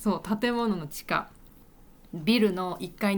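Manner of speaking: usual speaking voice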